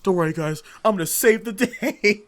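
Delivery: high, loud boastful voice; loud mock heroic boast; cocky hero. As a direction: brag like a kid hero. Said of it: "I was like".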